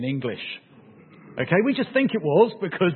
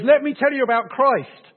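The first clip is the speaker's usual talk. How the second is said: high, loud, eager pitch; eager witnessing; 10 semitones higher, 7 dB louder than usual. Retold or imitated. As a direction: raise the voice high and keen, as an acted evangelist.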